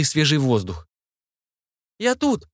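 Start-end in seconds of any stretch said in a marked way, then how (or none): 2.0-2.5 high-pitched excited call; high voice hailing others; calling from outside. A narrator's reading. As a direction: call up to those above. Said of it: "донёсся голос Инны".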